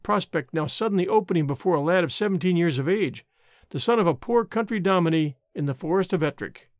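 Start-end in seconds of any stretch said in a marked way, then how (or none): none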